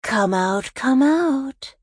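taunting singsong that climbs high; high, reedy call, like a poor Joker imitation; high, reedy villain. A narrator's reading. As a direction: lure them out in a thin high voice. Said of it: "his voice high and reedy".

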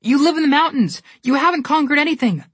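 high, loud defiant shout. A narrator's reading. Alex shouting at the Snow Queen, high and defiant. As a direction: throw the truth back at her. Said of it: "Connor added".

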